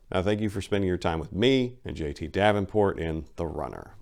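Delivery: ordinary delivery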